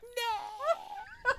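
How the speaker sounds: high mock terrified "No!"; terrified scream. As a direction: shriek like you're doomed. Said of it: "You're like".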